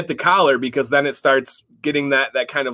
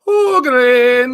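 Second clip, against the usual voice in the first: high, loud mock Irish "Hoagland" with a lilt; Irish accent; 10 semitones higher, 6 dB louder than usual. Host hams up the guest's name in an Irish accent. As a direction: put an Irish brogue on "Hoagland". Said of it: "the Ireland accent of how you say".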